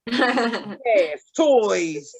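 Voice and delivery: "He has toys" dragged low and slow; exaggerated slow low repeat. Shown as a demonstration. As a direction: sink and drag it out with a laugh.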